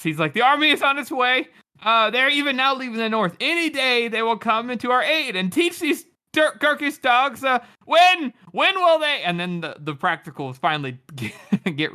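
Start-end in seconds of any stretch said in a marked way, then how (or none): 0.3-9.2 rallying. Acted. King reassuring crowd, then falters.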